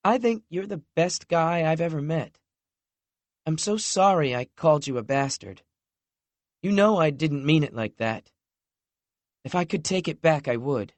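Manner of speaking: a hint of a contrite girlfriend; lightly voiced remorseful woman. A narrator's reading. Lexi apologizing to Knox for the insult, lightly voiced.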